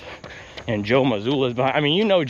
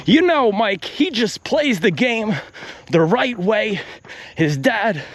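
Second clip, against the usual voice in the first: higher-pitched, drawn-out commentator impression; mock basketball analyst, earnest praise; Doris Burke; 4 semitones higher than usual. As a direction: gush slowly to your broadcast partner. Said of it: "Doris Burke impersonation".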